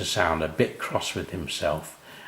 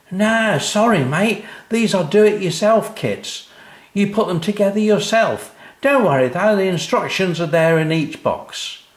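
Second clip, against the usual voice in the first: high, loud breezy workman; cheery lorry driver; 11 semitones higher, 5 dB louder than usual. A narrator's reading. Lorry driver waving Dad off, high and breezy. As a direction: toss it off to the customer.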